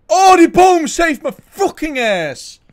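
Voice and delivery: loud, slow, high relief; relieved shout. Real, not acted. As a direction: yell it out in relief. Saved by tree in game.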